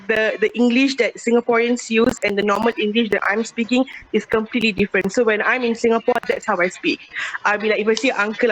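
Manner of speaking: ordinary talk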